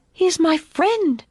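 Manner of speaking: put-on high, drawn-out child's protest; pleading little girl. A narrator's reading. Daughter defending her friend to father, pleading. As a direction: insist slowly in a high voice.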